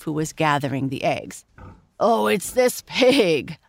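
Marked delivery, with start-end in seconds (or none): 2.0-3.6 laughing. Acted. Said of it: "laughed the farmer".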